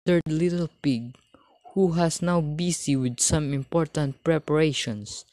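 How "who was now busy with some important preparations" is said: plain reading voice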